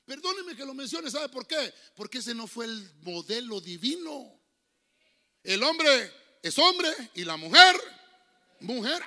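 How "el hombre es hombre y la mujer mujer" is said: much louder, slow, emphatic proclamation; preaching shout. Real, not acted. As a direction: hammer home each word to the church. (proclamando fuerte y lento)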